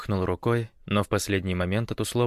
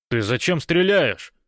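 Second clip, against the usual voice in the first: slow, loud, higher call; shouting; 7 semitones higher, 5 dB louder than usual. A narrator's reading. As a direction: call out across the distance. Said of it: "крикнул раненый".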